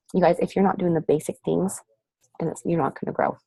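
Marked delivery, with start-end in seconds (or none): none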